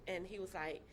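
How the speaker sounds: ordinary talk, nothing marked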